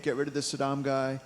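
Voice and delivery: ordinary delivery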